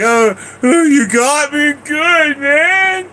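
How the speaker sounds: teary little brother. Retold or imitated. Cousin returns to the room, high drawn-out teary whine. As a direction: whimper it out like the sulking cousin coming back. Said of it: "he's like".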